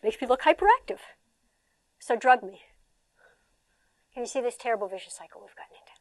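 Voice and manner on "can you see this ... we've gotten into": fast whisper; whispered aside. Real, not acted. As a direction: confide it in a whisper.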